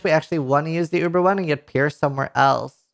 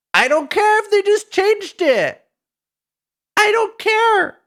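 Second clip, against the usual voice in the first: much higher, slow, put-on whiny voice; whiny mock protest; 3 dB louder, slower than usual. Streamer telling chat he doesn't care, high slow whiny voice. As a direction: stubbornly insist like a sulky kid, pitched way up. Streamer brushing off patch change.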